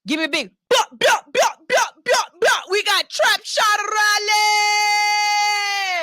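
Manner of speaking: high, punchy bops, then stretched-out name; high-energy artist introduction, held long at the end; hype announcer. Real, not acted. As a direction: shout out the guest like a club hype man. Host hypes up and introduces the artist.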